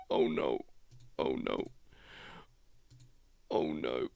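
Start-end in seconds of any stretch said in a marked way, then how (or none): 0.1-0.6 whispered oh no. Pretend. Whispered mock dismay.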